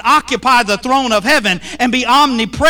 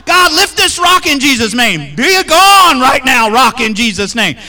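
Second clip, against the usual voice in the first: higher, louder hammed-up prayer shout; mock loud prayer; 4 semitones higher, 4 dB louder than usual. Preacher mocking a church praying loudly at the rock, louder and higher.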